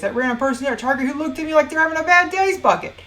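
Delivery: fast, rising breathless-style run-on; exasperated list climax. Acted.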